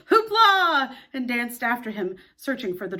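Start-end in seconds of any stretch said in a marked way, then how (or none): 0.1-1.0 high, loud joyful shout; joyful cry. Acted. The boys following Mister Darling, joyful whoop. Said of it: "they all cried".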